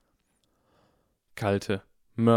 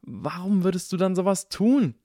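higher, exasperated protest; indignant; 10 semitones higher, 4 dB louder than usual. A narrator's reading. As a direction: demand a reason in disbelief. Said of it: "rief Draco empört aus".

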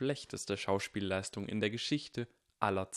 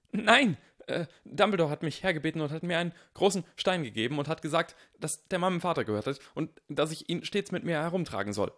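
fast, high, louder flustered explanation; panicked rush; 7 semitones higher, 5 dB louder than usual. A narrator's reading. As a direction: gabble it out, caught and panicking. Harry panicking under questioning.

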